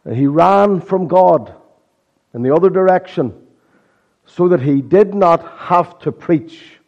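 impassioned sermon delivery; preaching. Acted. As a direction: preach it to the congregation. Preaching with rising force.

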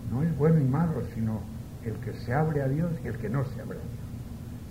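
usual speaking voice

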